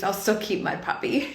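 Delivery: ordinary talk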